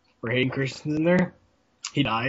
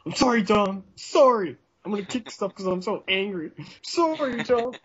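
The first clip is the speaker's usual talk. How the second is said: whiny apology; 8 semitones higher than usual. Retold or imitated. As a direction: whimper it like an overblown crybaby. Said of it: "being a whiny bitch".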